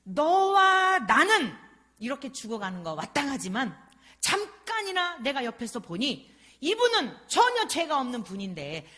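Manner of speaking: impassioned thief crying out in his defense; thief's rebuke. Retold or imitated. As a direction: defend the innocent man at full voice. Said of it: "한편 강도가 그 변호를 하는 거예요".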